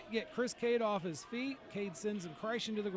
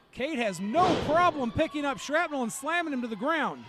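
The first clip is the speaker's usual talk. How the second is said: rising, louder play-by-play call; excited call; 6 semitones higher, 9 dB louder than usual. Real, not acted. Play-by-play climax.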